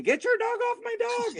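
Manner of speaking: high, shrill-free mock yell of a frantic owner; imitating the screaming dog owner. Retelling the lab owner screaming at him, hammed-up high yell. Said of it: "like, screaming".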